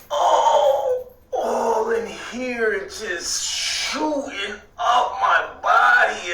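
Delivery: a high cry that breaks into a slow, drawn-out wail; overwhelmed crying out. Retold or imitated. Overwhelmed by spiritual energy, crying.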